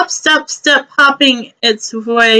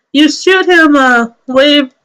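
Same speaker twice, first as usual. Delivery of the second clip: ordinary talk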